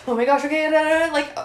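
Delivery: high, loud panicky babble; mock panic. Imitating her old panic, high and loud. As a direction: spiral into panic.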